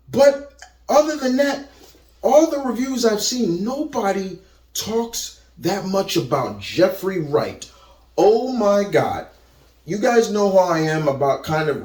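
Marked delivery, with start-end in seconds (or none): none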